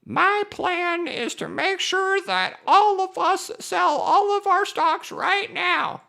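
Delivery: put-on high, slow old lady voice; old grandma voice. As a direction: play a slow quavering granny. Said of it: "You guys like my old grandma voice?".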